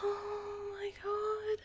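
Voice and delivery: very high, slow, stretched exclamation; overwhelmed moan. Real, not acted. Overwhelmed mid-challenge, high stretched moan.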